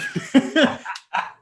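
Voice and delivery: a short high laugh. Real, not acted. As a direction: laugh at the punchline of the story. Laughs at his own story.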